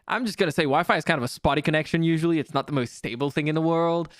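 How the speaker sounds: ordinary talk, nothing marked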